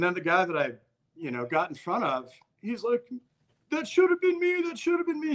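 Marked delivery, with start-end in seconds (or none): 3.7-5.3 very high, wailing mock lament; distraught friend imitated. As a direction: imitate a buddy's anguished guilt. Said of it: "He's doing the whole thing".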